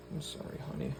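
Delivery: soft breathy whisper to a pet; whispered apology. Real, not acted. Owner whispering sorry to her fish. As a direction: hush it out tenderly.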